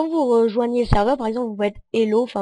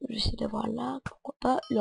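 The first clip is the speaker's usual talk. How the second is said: whispering; 8 dB quieter than usual. Real, not acted. Sneaking up on a player.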